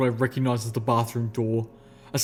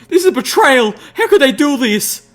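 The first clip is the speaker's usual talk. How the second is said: furious scream; 13 semitones higher, 11 dB louder than usual. A narrator's reading. Applejack shattering her bureau.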